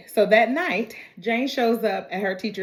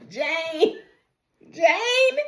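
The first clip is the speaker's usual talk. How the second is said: rising, ever higher calls of the name; exasperated calls; 6 semitones higher than usual. Real, not acted. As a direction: call out her name, pitch climbing in disbelief. Exasperated by the character.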